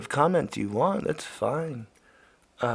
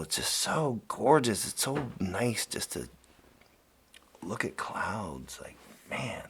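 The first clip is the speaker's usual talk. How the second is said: soft and dreamy; 5 semitones lower, 5 dB quieter than usual. Real, not acted. Dreamy about clouds.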